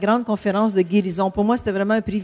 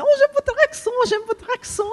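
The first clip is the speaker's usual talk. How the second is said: very high, gushing imitation of fans; admiring fans. Preacher imitating fans who love the couple's accent, high-pitched gushing. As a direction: squeal it in a mock falsetto. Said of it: "ils aiment beaucoup notre accent".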